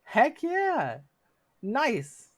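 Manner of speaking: big high shout of delight; excited cheer. Real, not acted. Streamer cheering a viewer's good news, loud and high. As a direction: cheer the viewer on at full volume.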